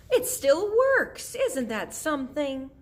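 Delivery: very high, loud, drawn-out marvelling; delighted exclamation. Acted. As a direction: crow with amazement, stretching the words. Tootie exclaims at the shining lamp.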